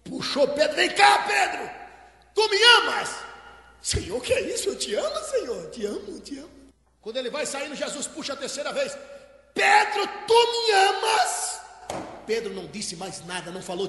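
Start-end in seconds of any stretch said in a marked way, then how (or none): 0.8-3.2 high, raised-voice question; high-pitched, insistent acted call; insistent demand. Jesus pulls Peter back, asking again. 3.8-6.5 acted hurt protest, dying down. Peter protests his love to Jesus. 9.5-11.6 very high, drawn-out shouted question; high, stretched, insistent call; emphatic demand. Acted. Jesus asks the third time.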